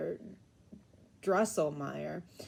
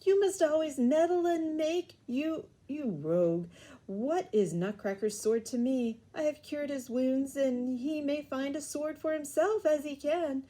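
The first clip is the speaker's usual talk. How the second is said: put-on a higher, scolding snap at the boy; angry; 7 semitones higher, 3 dB louder than usual. A narrator's reading. Godfather angrily scolds Fred for meddling, higher and sharp. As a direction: scold the meddling rogue, pitched up. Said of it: "replied Counselor angrily".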